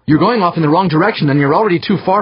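loud, high exasperated tirade; angry bellow. A narrator's reading. Crewman explaining to drifting bleeder, angry bellow. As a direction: keep shouting, pitch held high. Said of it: "the angry male bellowed".

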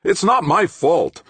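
loud, high, slow protest; insistent. A narrator's reading. Blamed engineer insisting to the professor, loud and defensive. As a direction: insist on innocence, drawn out and high. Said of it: "Obermann insisted".

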